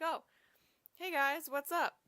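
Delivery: high, loud breezy "hey guys"; mock-casual president greeting; casual laid-back greeting. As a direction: toss it off like a chill host. Jefferson casually greets guests.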